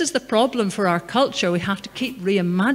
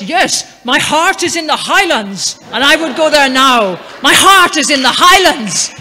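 booming out Burns' lines like a proud declamation, louder; loud poetic recitation; 6 semitones higher, 11 dB louder than usual. Retold or imitated. Russian stranger reciting Burns to Scots, loud and proud. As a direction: proclaim the verse loudly to the street. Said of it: "And he says".

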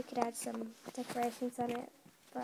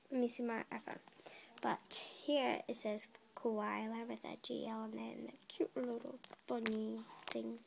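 ordinary talk, nothing marked

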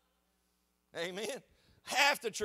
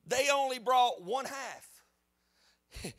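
emphatic slow; 3 semitones higher, 3 dB louder, much slower than usual. Real, not acted. Preacher slows for emphasis.

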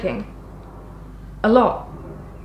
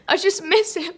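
voice starting to break; 6 semitones higher than usual. Acted. Grief welling up in monologue.

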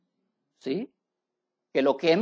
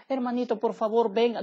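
higher, softer mock begging; mock pleading; 5 semitones higher, 3 dB quieter than usual. Pastor imitating a believer begging God softly. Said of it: "Porque nosotros no entendemos".

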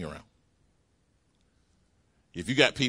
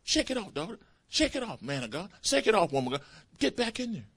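encouraging coach; 12 semitones higher than usual. Retold or imitated. Holy Spirit as coach urging believers, high coaxing call. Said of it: "our coach, says".

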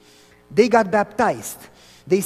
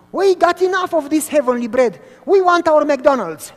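high, loud mocking whine of the grumbling crowd; mock the spoiled crowd demanding fast food; whining complainers; 7 semitones higher, 8 dB louder than usual. Preacher mocking the Israelites' complaint, loud whiny voice.

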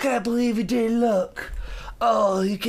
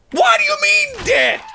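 much higher, loud indignant yell; outraged shout; 8 dB louder than usual. Real, not acted. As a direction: shout it in disbelief. Disbelief at being called dead.

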